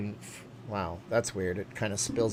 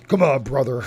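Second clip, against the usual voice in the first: louder raspy wrestler growl; Hulk Hogan voice; 7 dB louder than usual. Acted. Streamer doing Hulk Hogan for chat, louder raspy wrestler. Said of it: "Hulk Hogan's voice".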